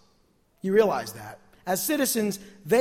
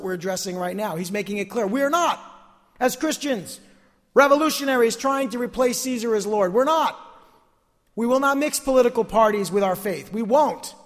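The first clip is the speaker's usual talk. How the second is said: emphatic, higher-pitched pulpit denial; insistent preacher in raised voice; full-force preaching; 5 semitones higher, 6 dB louder than usual. Real, not acted. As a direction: hammer each denial home.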